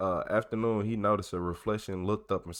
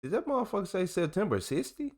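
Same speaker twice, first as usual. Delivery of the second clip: incredulous; 11 semitones higher than usual. Real, not acted. Misreading a date in a report.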